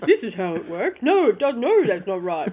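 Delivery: stubborn old man, lightly voiced; grandfather voice. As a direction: insist like a grumpy grandpa. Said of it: "my grandfather voice".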